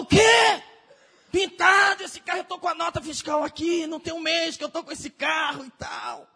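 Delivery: outraged shout. Retold or imitated. Pastor outraged his new car was repainted, shouting. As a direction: explode at the mechanic. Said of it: "o pastor ficou louco".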